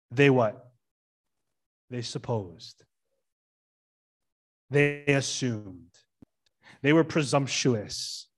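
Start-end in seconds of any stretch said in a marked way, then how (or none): 1.9-5.9 dropped-voice emphasis. Shown as a demonstration. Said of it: "this is a big word".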